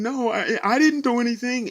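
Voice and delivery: high, loud, tearful protest; crying denial. Retold or imitated. To the union rep, crying that it's not her fault. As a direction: plead your innocence through tears. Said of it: "I'm crying and saying".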